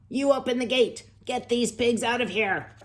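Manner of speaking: loud, high scolding command; angry father. Acted. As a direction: order her to get the pigs out. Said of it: "yelled his, her father".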